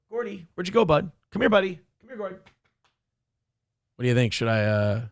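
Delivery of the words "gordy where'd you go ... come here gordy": high coaxing call to the dog. Real, not acted. Owner coaxing Gordy the dog over, high call. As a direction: coax the dog over, pitched up.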